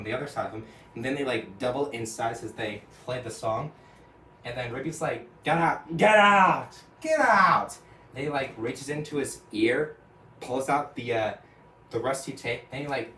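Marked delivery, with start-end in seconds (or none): 5.4-7.8 building from a loud cry to a full high shout; panicked building shout; frantic yell. Retold or imitated. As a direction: scream at the thing to leave. Rigby chased by boomboxes.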